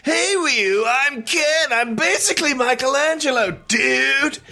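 very high cartoon American dude; Ken impression. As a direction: squeal it out like an over-the-top dude. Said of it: "what does Ken sound like?".

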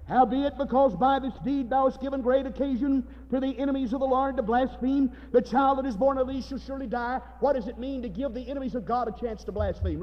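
high, loud pulpit declamation; forceful Scripture reading. Real, not acted. Preacher reading judgement on David, forceful declamation. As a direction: proclaim the verse with sustained force.

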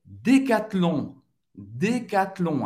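Decathlon slow, with the T and nasal on; French pronunciation. Shown as a demonstration. Teacher introduces Decathlon slowly. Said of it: "A gente vai pronunciar o T".

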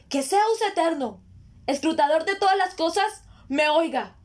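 high, ringing oath to a god; solemn invocation. Acted. Ruler calls on eternal Zeus to hear him, solemn invocation. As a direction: lift the voice, calling the heavens to witness.

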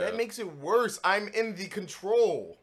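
high, loud panicked protest; freaking out about the game; excited. Real, not acted.

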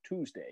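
"Tuesday" pronounced "Toozday" with a double O; the American "Tuesday" said as "Toozday"; "Tuesday" with an "oo" sound and no y glide. Shown as a demonstration. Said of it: "Double O rather than a U".

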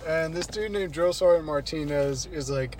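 ordinary delivery

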